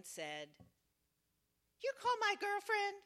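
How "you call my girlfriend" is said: high, loud suspicious demand; possessive challenge. Retold or imitated. Angry woman on the phone, suspicious and possessive. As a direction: confront the caller who phoned her girlfriend. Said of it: "in a very proprietary way".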